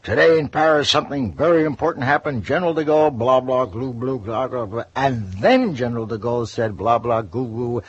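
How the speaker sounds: high, loud mock radio news through static; a comedian mocking a radio correspondent, high and loud; newsman parody. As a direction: ham up a crackly foreign correspondent. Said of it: "he would say".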